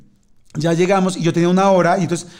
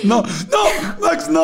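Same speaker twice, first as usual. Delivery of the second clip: mock panicked shout; 10 semitones higher, much slower than usual. Joins in, acting scared.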